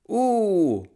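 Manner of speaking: high, loud call to a horse; tiny driver calling out to the horse; shouted driver's cry. A narrator's reading. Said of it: "gli gridava". (grido acuto da carrettiere)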